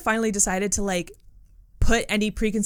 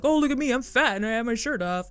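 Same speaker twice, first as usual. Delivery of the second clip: hammy comedian. Retold or imitated. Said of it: "hamming it up".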